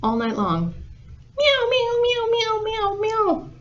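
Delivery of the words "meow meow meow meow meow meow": very high-pitched imitated meows; squeaky cat impression; cat meowing. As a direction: meow over and over like a cat. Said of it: "what sound do you think the cat make".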